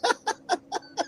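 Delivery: high, soft burst of giggling; high giggle. Real, not acted. Guest giggles at the chat's naked joke, high and soft.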